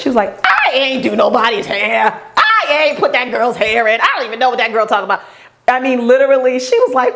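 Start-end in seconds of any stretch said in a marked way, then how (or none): 0.4-5.2 put-on, unprofessional stylist voice, speeding up at the end; indignant stylist. Stylist denying the job to the rep, indignant. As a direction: imitate an indignant denial. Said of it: "this is not gonna sound professional".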